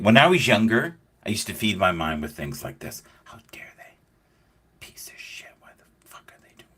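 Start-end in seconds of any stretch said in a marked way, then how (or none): 3.2-6.7 angry muttered thoughts under the breath; seething whisper, voiceless; whispered inner rant. Retold or imitated. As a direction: whisper it like stewing in your own head.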